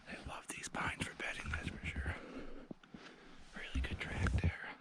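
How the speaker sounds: hushed whisper while stalking. Real, not acted. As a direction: whisper so the deer can't hear.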